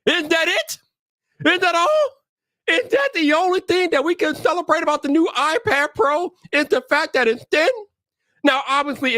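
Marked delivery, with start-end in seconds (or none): 0.1-7.7 high-pitched exasperated questioning; incredulous self-quote. Acted. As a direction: ask in high, mock disbelief. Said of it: "I'm just thinking to myself".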